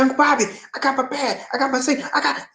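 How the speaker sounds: put-on high-pitched excited young relative; thrilled, high retold boast; happy nephew imitation. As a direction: imitate him proudly reporting in, voice way up. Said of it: "he was so happy".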